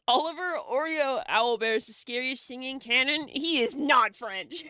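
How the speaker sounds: 大声、放慢，一字一顿地坚持; emphatic loud insistence. Real, not acted. Insisting the character isn't French.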